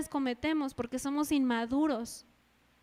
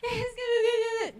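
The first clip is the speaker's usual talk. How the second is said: very high, tearful whine; crying child; 9 semitones higher than usual. Acted. Child trying to explain to mom, mock crying. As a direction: imitate a sobbing kid on a high whine. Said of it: "llega el niño llorando".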